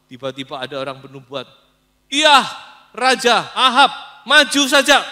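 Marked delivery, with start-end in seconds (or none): 2.1-3.9 eager prophets. Acted. Prophets urge Ahab to war. 4.3-5.0 maju saja shouted high and loud, cheering; eager shout. Acted. False prophets shouting encouragement at King Ahab, loudly.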